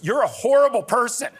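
high, loud imitation of a scolding child; hammed-up childish outrage; mock indignation. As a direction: cry it out like an outraged kid at the screen.

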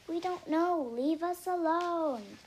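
loud, high, drawn-out protest; exasperated shout. A narrator's reading. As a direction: shout to be left alone. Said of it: "they shout".

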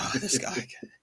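muted weary exasperation; annoyed Joker. Retold or imitated. Joker seeing his enemy turn up, softly annoyed. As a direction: groan it softly, fed up. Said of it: "He was legit annoyed".

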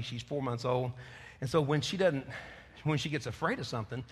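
usual speaking voice

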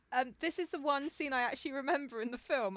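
normal talking voice

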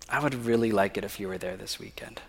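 breathy whisper of a shy request; whispered plea. Retold or imitated. Girl whispering to him on the phone, hushed shy request. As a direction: ask softly, almost unvoiced. She asks him to stay.

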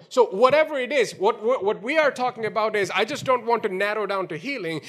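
ordinary talk

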